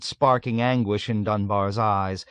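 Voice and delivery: ordinary delivery, nothing marked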